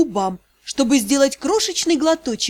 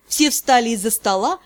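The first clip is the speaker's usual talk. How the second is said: plain reading voice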